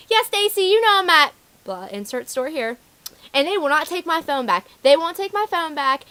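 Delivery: high, loud mock phone rant; yelling mom. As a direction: imitate an outraged mother complaining by phone. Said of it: "the mom's still yelling at me".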